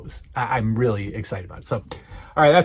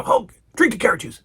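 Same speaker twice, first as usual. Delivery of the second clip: higher, bossy celebrity impression; Mister T; 7 semitones higher than usual. Mister T telling Hulk to drink, bossy impression. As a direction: order it in a raised mock voice. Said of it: "And he goes".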